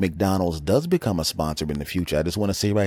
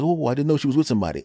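hurried apology in a raised voice; flustered quick excuse, high; 8 semitones higher, much faster than usual. Retold or imitated. Friend apologizing to the big boyfriend, flustered and quick. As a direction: back off fast, apologizing. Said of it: "he goes".

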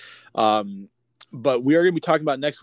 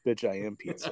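low, softer joking reply; mock-cool comeback; 4 semitones lower, 7 dB quieter than usual. Answering "you smell like pizza" as himself, mock-cool. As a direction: drop it low for the punchline. Acts out his reply in joke.

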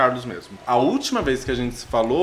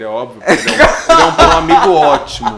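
louder words through laughter; laughing while talking; 2 semitones higher, 12 dB louder than usual. Real, not acted. (falando rindo)

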